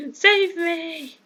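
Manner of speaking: high, hammed-up plea through a laugh; mock cry for help. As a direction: cry out like a damsel in distress.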